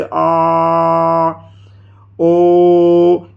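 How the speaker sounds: "आ" and "ओ" each held very long on a level pitch; chant-like drawn-out "आ… ओ…"; prolated vowels. Shown as a demonstration. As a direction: sustain each vowel steadily, well beyond two beats. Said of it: "दो से अधिक मात्राओं का जब हम समय लगाएंगे".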